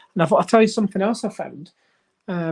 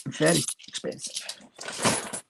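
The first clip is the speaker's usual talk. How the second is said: slow, softer whispered afterthought; whispered aside; 3 semitones lower, 8 dB quieter, much slower than usual. Real, not acted. Collector grumbling about the cost of two pound coins, slow soft whisper. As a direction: murmur it to yourself.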